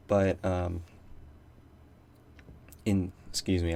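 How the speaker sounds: talking through hiccups. Real, not acted. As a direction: hiccups breaking the words. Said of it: "I'm hiccuping".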